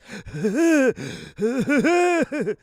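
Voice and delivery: high squealing wheeze laugh; wheezing laugh. Real, not acted. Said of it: "I sound just like that".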